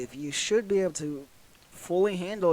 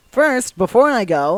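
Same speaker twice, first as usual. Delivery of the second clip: sudden loud exclamation; 5 semitones higher, 11 dB louder than usual. Real, not acted. Sudden remembering, calling out.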